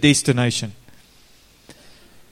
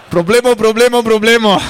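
higher, louder mock alarm breaking into a laugh; laughing captain imitation; 6 semitones higher, 6 dB louder than usual. Preacher repeating the captain's radio call to the congregation, laughing. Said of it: "he started all his conversations like this".

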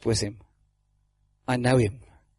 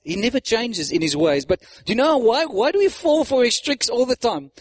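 ordinary talk, nothing marked

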